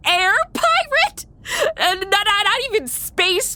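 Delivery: squeaky, high laughing through the words; barely getting the words out between laughs. Real, not acted. Player cracks up at the line.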